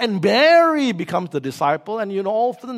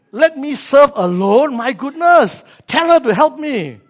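higher, louder, slow indignant complaint; outraged Martha demanding help, higher and louder; 7 semitones higher, 8 dB louder, slower than usual. Acted. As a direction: protest like an overworked sister. Martha upset with Jesus.

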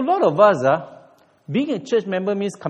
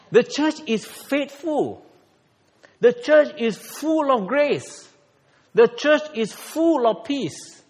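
solemn proclamation; 7 semitones higher, 3 dB louder, slower than usual. Acted. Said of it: "preaching proclamation".

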